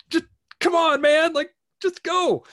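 high, loud yell at a slow driver; frustrated yell. Retold or imitated. Stuck behind a slow driver, yelling in frustration. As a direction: shout it through the windscreen, fed up. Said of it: "and you're just like".

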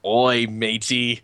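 piratey Australian accent, drawn out; bad Australian accent. Shown as a demonstration. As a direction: attempt Australian and land on pirate. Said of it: "Do your best Australian accent".

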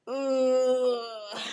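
long whimpering groan; mock tearful groan. Stranded driver told he can't help, mock tearful. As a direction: draw it out as if about to cry. Holding back tears at bad news.

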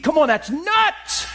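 high, slow cry of disbelief; incredulous exclamation. Real, not acted. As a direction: fling it out like it's absurd.

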